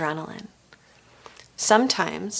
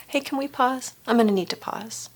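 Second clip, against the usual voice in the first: "can we pause" higher and soft, asked rather than ordered; gentle question, no demand; 4 semitones higher, 4 dB quieter than usual. Shown as a demonstration. Coach showing a client how to ask for a break, gentle question. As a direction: float "can we pause" up softly as a request. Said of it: "It's not even a demand".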